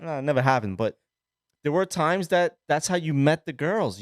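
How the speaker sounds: ordinary talk, nothing marked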